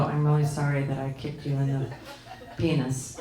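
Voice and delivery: imitating a kid's grudging apology, low and monotone; insincere forced apology. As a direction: drone it like a child made to apologize. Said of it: "they're not sorry at all".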